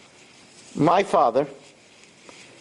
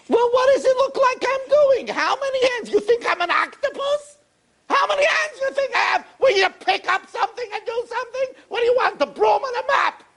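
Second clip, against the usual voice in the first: angry wife. Acted. Wife yelling at husband.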